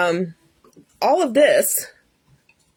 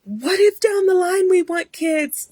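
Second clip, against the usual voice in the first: high-pitched tearful plea, imitated; mock crying; 11 semitones higher than usual. Upset wife pleading with husband about kids, mock crying. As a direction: wail it like a retold weepy protest. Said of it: "I'm crying".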